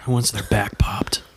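creepy ASMR whisper. Pretend. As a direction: whisper it close to the mic.

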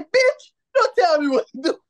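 high, loud mock outrage; high-pitched retold telling-off; indignant comeback. Retelling his angry comeback to girl.

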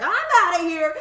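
high, loud hasty exit line; a startled boy fleeing the room; kid bailing out. Retold or imitated. Son escaping the awkward talk, high and loud. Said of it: "he's like".

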